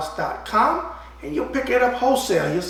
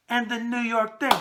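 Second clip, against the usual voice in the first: ordinary delivery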